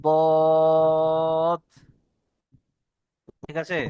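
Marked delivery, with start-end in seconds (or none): 0.0-1.6 বদ with one long, level held vowel; বদ drawn out slowly as a model sound; articulation demo. Shown as a demonstration. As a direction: stretch out বদ on a steady note. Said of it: "লাগিয়ে উচ্চারণ হয়".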